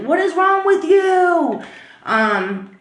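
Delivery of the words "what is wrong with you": high, drawn-out exasperated shout; freaking out. Retold or imitated. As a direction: imitate a dad losing it at his kid. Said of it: "freaks out on him".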